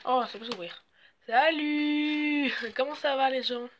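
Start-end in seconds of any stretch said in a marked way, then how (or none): 1.3-2.5 high, loud, long held hello; exuberant greeting. Acted. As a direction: sing out the hello. Greeting the others after winning. (salut claironné)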